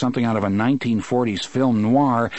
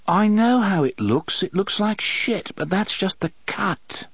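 high, slow clipped English schoolboy voice; a hint of a sly posh Brit; British public school accent; 9 semitones higher than usual. A narrator's reading. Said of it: "the sallow thing said".

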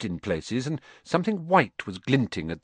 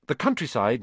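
usual speaking voice

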